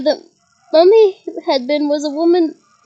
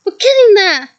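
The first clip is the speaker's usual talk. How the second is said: excited shout; 5 semitones higher, 7 dB louder than usual. A narrator's reading. Teacher cheering class on.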